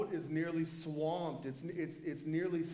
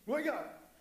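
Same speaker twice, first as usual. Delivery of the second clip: mock urgent disciple; 9 semitones higher than usual. Replays disciples waking Jesus.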